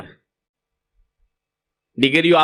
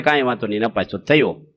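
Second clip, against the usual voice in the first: gentle reassurance; 8 semitones lower than usual. Shown as a demonstration. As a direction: tell a failed son it's fine, kindly and low. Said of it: "તમારે કહેવાનું".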